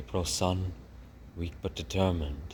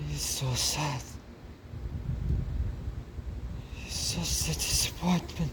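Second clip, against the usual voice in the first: high, dramatic French mother mouse; 9 semitones higher than usual. Acted. New mother after birth, asking for her babies, high and dramatic. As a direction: play the weary French mother, high and theatrical.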